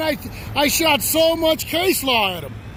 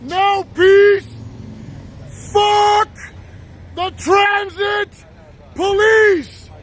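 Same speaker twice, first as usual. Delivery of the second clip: angry slow shout; 2 semitones higher, slower than usual. Real, not acted. Man showing police what vulgar sounds like, shouting angrily. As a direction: bellow each phrase slowly, with pauses between.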